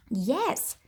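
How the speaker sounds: ordinary talk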